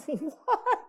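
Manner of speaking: high-pitched, baffled mock reaction; astonished. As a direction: play up a startled dad's disbelief. Startled father reacting to the roar.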